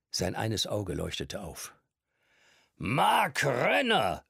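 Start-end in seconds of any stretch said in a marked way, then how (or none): 2.8-4.2 high, slow call across a noisy pub; old man calling out. A narrator's reading. Old man calling to Mark across the pub, slow and high. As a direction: stretch the name out over the din. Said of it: "rief er über den Lärm hinweg".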